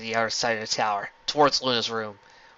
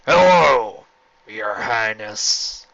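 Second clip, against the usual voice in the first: sly; 2 semitones lower, 6 dB louder, much slower than usual. A narrator's reading. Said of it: "a sly smile".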